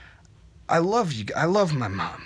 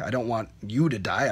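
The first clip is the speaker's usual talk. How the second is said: usual speaking voice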